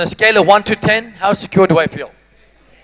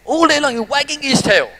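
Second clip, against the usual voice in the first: ordinary talk, nothing marked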